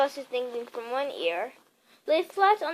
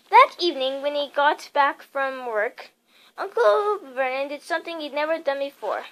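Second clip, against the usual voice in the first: usual speaking voice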